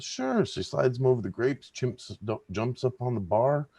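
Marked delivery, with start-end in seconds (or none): 0.0-0.4 very high, chirpy sure; owner. Acted. Owner agreeing to the grapes, very high voice. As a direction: squeak out a quick yes.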